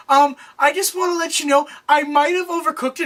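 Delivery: high, nervous confession; imitated freaking out. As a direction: act out a panicky admission. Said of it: "freaking out".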